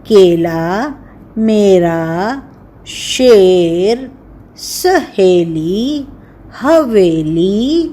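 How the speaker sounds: put-on each word stretched slowly for children; slow teaching words. Shown as a demonstration. Teacher reading a word list to children, slowly. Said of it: "शब्द होते हैं".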